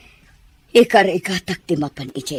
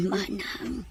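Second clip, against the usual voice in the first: frail, breathy plea; weak whisper; 3 semitones higher, 10 dB quieter than usual. Acted. Sick woman begging her sister for water, barely whispering. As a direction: whisper it like you are fading.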